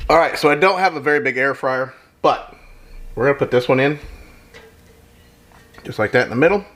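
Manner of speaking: ordinary talk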